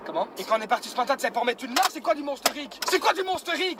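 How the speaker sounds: normal talking voice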